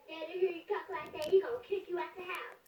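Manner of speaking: fast, hushed whisper; rushed tattletale whisper; whispered warning. Acted. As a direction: lean in and whisper the threat fast. Tattling to the girl aside.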